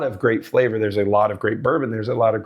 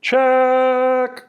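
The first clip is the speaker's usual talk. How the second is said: mic check; 11 semitones higher, 4 dB louder than usual. Shown as a demonstration.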